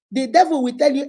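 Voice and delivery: usual speaking voice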